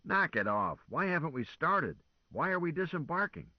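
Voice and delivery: a commander wanting answers now; impatient demand. A narrator's reading. Lazarus angrily demands answers.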